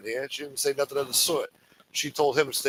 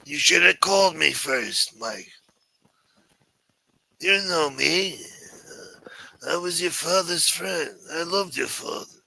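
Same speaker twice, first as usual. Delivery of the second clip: higher, grandiose disappointed mafioso; Chris Colombo impression; 5 semitones higher than usual. Imitating Chris Colombo guilting Mike about his father and Rome, in a higher voice. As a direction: guilt him with old-world pride. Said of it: "You do a good Chris Colombo?".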